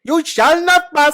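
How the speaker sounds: loud, high, slow shout of the line in a mock Indian accent. Friend challenges him to an Indian accent, he shouts it. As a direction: bellow it like an Indian Gandalf. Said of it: "in an Indian accent".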